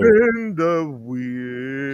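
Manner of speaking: very slow stretched chant; drawn-out call. Pretend. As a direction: drag every word out. Calling for wheel spin.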